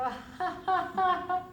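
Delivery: high, loud staged ha ha; demo laugh. Shown as a demonstration. Teacher shows the class how to laugh at themselves, loud ha ha. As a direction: laugh on purpose, one ha at a time. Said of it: "we have to laugh, we have to go".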